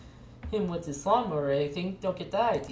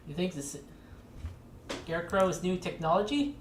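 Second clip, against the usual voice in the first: ordinary talk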